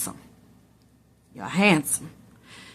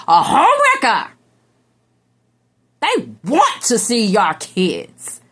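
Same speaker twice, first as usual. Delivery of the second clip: mock outrage; 2 semitones higher than usual. Mocking the side piece.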